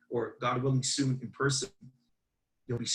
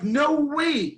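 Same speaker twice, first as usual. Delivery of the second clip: loud, high-pitched "no way" exclamation; freaked-out disbelief; 12 semitones higher, 6 dB louder than usual. Retold or imitated. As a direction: retell it as a stunned outburst. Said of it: "I freak out".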